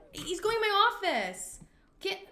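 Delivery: high, loud disbelief; alarmed outcry. Real, not acted. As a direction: cry out at an intruder's nerve. Intruder enters her office.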